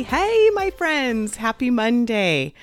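ordinary talk

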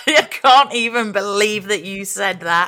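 loud, high, slow playful indignation; mock outrage. Co-host scolding the toothpaste answer, mock outrage. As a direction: scold teasingly, dragging it out after a laugh.

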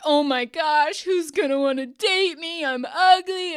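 imitating her former self melting down over looks; old insecure self. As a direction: play your old spiraling self, hammed-up. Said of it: "an old version of [name] would have been like".

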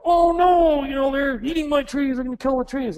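high, panicky mock alarm; worried farmer. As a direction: squeal it like a fretting farmer. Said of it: "you might go".

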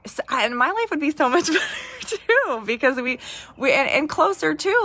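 high voice breaking into a laugh; tearful laugh. Real, not acted. Wife admitting selfish hopes for marriage, tearful laugh. Tearful confession of her own wish.

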